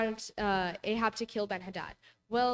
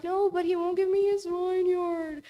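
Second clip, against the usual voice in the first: high, louder whiny sulking king; 9 semitones higher, 5 dB louder, slower than usual. Retold or imitated. Teacher voicing sulking King Ahab to the class, high whine. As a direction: whine it like a spoiled king denied a toy. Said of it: "And then he's like".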